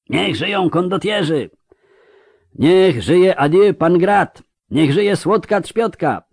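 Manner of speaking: high, ringing shouts of acclaim; cheering crowd. A narrator's reading. Crowd greets the mercenaries riding down the main street, cheering shouts. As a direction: hail them like a yelling crowd. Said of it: "wiwatami i wrzaskiem tłumu".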